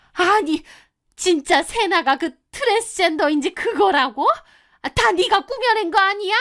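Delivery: put-on high-pitched, agitated older woman's voice; disbelieving mother. A narrator's reading. Groom's mother accusing the narrator of making it up, incredulous.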